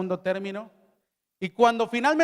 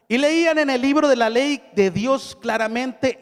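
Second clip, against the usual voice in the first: solemn Bible reading, a little louder; scripture reading; 4 dB louder than usual. Acted. Pastor reads Nehemiah to the congregation, solemn. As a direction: read the verses out to the church with weight.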